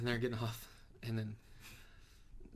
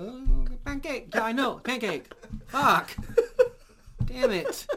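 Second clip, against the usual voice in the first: much higher, louder slow lament; mock grief; 11 semitones higher, 7 dB louder, much slower than usual. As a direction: wail the name in hammed-up sorrow. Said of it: "he's like".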